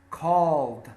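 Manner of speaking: "Called" ending in a voiced D. Shown as a demonstration. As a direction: say "Called" as calld, one syllable. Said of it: "Pronunciation of D and T are extremely similar".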